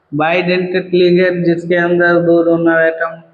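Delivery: normal talking voice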